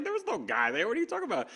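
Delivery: high, laughing disbelief; baffled wife voice. Retold or imitated. Wife denying any man was there, high and baffled.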